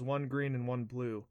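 plain reading voice

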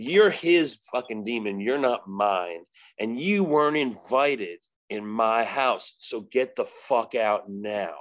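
slow, low, hard command; tough as nails. Retold or imitated. Man casting a demon out of his house, tough and firm. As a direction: order it out like someone who won't back down. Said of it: "tough and as hard as nails".

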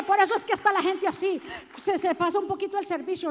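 ordinary delivery

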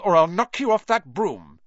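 high, loud threat; called threat. A narrator's reading. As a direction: call out a challenge across the air. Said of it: "Harry called".

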